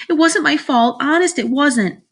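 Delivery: put-on high, upset girl's protest; crying out defensively. A narrator's reading. Sheila denies blame for the boy's fall, high upset protest. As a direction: plead innocence in a high wail. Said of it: "Sheila cried".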